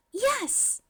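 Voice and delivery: high, bright burst of eagerness; eager yes. A narrator's reading. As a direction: shoot it out eagerly. Mei eagerly confirms.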